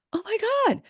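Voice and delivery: high, loud gasp of sudden realization; excited revelation. Acted. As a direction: act out an overjoyed listener finally getting it. Acting out a listener's revelation.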